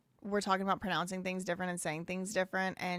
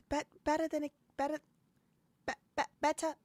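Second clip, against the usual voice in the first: reaching higher for British better, be-, be-, better; stumbling British accent; 7 semitones higher, faster than usual. Retold or imitated. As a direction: streamer fumbles a British accent on the word better. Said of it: "I gotta work on my British accent".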